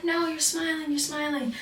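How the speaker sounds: high, insistent mimicry; nagging high-pitched repetition; imitated accusation. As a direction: insist in a high mock scold. Retells instructor insisting.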